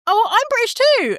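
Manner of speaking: high-pitched, gushing quoted exclamation; eager claim. Acted. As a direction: chime in as an overeager newcomer. Acting out an eager fellow Brit.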